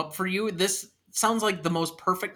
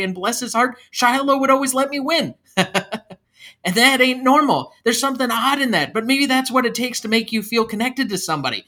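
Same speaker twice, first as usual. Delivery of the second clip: lightly manic confession, pitched up; Jeremy confessing, worked up; 5 semitones higher, 4 dB louder than usual. Acted. Jeremy opens up to the priest, excited rambling confession. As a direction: ramble on, keyed up and a touch unhinged.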